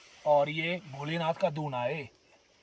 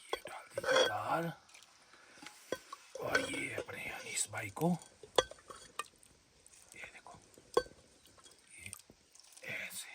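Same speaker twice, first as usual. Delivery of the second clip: slow, hushed whisper; 5 dB quieter than usual. Real, not acted. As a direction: whisper it so the mic barely catches it. Quiet aside while serving food. (धीमी फुसफुसाहट)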